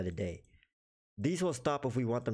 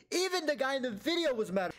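much higher, incredulous exclaim; amused disbelief; 12 semitones higher than usual. Real, not acted. Pointing out the irony to viewers, much higher. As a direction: marvel at the absurdity.

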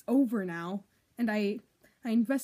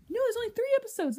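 indignant; 12 semitones higher, faster than usual. Real, not acted. Outraged complaint.

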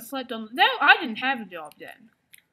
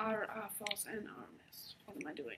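whisper; 4 semitones lower, 18 dB quieter than usual. Real, not acted. Whispering to herself.

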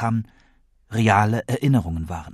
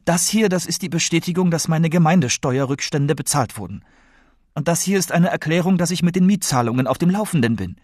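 lightly voiced grandmother, higher, laying out evidence; a higher-pitched, pressing grandmother; insistent grandmother; 7 semitones higher than usual.

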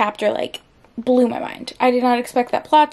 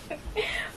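shaky teary laugh; 10 semitones higher, 11 dB quieter than usual. Real, not acted. Laughing through tears.

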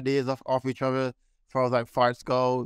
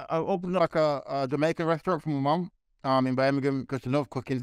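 ordinary talk, nothing marked

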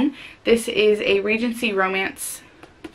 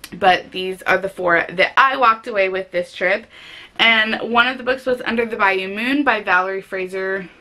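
usual speaking voice

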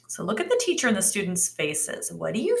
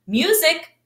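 commanding; 4 semitones higher than usual. Acted. Dance teacher commands the piano player, high and grand. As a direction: snap the order like a grand dame. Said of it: "she commanded the piano player".

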